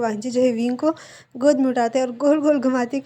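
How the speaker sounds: usual speaking voice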